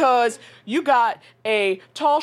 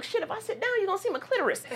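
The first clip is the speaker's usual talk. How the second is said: much higher but much softer; panicked aside; 11 semitones higher, 8 dB quieter, much faster than usual. Retold or imitated. Herself worrying about her tiny dress, hushed and high. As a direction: fret to yourself in a hushed squeak.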